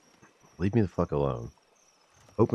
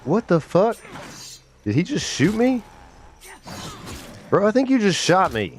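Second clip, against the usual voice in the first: shocked outburst; 13 semitones higher than usual. Real, not acted. Gamer shot by his friend, high shocked yelp. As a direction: yelp it, caught off guard. Friend shoots him in game.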